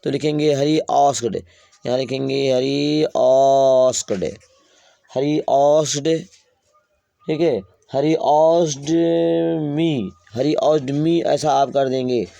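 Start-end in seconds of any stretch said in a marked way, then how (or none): 0.5-11.2 very slow, drawn-out "Hari asked me"; slow dictation. Shown as a demonstration. Teacher writing the indirect sentence on the board, very slow. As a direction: dictate each word as you write it.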